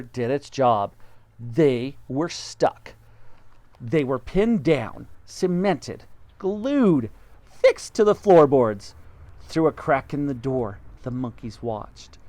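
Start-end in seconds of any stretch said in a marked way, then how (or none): 1.4-8.9 slow, emphatic listing; dramatic build. A narrator's reading. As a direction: build it word by word. Dramatic narration.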